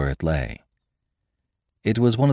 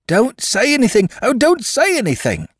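much higher, loud and frantic; ecstatic cry; 4 dB louder than usual. A narrator's reading. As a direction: cry out in delighted frenzy. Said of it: "cried the atheist cobbler, dancing about in an ecstasy".

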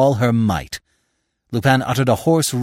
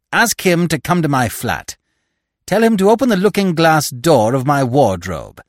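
raised, emphatic telephone voice; imperious widow telephoning; 4 semitones higher, 5 dB louder than usual. Acted. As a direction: dictate an urgent message down the line.

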